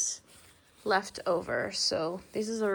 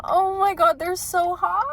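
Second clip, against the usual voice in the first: excited gush; 8 semitones higher, 7 dB louder than usual. Real, not acted. Gushing over new item.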